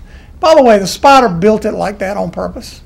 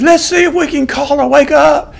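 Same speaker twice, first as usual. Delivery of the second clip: higher-pitched imitation of an Australian TV naturalist; Australian accent; 5 semitones higher than usual. Nature-show host about to wake a spider, in a mock Australian accent. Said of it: "I'm gonna quit saying my Australian accent".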